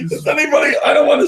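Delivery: trying to talk while still cracking up; laughing. Real, not acted. Host still laughing while asking the audience, high and broken.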